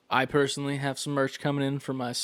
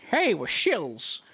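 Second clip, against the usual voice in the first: slow, high hammy pitchman; shill ad voice; 6 semitones higher, much slower than usual. Pretend. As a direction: drawl it out like a cheesy ad. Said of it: "That's how I talk every day".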